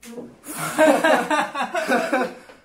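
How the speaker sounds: high, loud laugh through the burn; pained laughter. Real, not acted. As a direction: laugh helplessly at the spicy pain. Chili burning, laughing fit.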